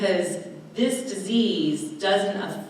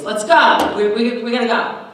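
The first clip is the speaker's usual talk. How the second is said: loud, higher, rushed "let's go"; impatient tone; 6 semitones higher, 10 dB louder than usual. Retold or imitated. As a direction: hurry them along, sharp and irritated. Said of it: "in that tone of voice".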